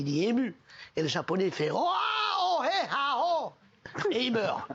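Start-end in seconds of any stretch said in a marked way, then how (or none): 1.7-3.5 high strangled oh-ah cries; choked, higher cries of a man being squeezed; choking gasps. Acted. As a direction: gasp and cry out as if being crushed. Japanese friend dies being hugged.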